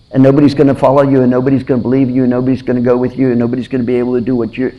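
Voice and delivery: fast rattled-off taunt; taunting nations. Retold or imitated. Preacher voicing the mocking nations, fast taunting. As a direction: rattle the mockery off quickly. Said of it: "That's what the nations were saying".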